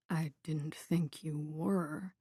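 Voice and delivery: hushed, slow and soothing; calm her down gently; conciliatory. A narrator's reading. Wounded man to the armed woman, conciliatory and hushed. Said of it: "He takes a conciliatory tone".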